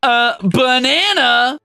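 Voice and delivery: high, slow teasing announcement; a goofy, slow naming ceremony; playful reveal. Acted. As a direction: draw out the silly new name. Renaming a bat playfully.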